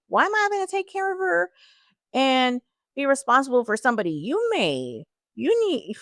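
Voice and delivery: high indignant complaining; whiny teenage outrage, retold; mock angry teen. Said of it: "I actually got mad at my family".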